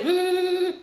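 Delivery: high, taunting hums; mocking hum. Real, not acted. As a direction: hum it out to mock. Mocking hum after a name.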